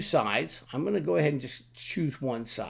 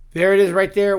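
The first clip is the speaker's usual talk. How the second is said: there it is right there, louder and higher; excited reveal; 6 semitones higher, 12 dB louder than usual. Shown as a demonstration. Teacher showing off the finished grid, excited. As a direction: proudly announce there it is.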